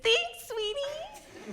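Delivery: put-on very high, drawn-out drunken coo; hammed-up tipsy sweet talk; mock tipsy gushing. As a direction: stretch it out high, far too familiar. And I repeat it.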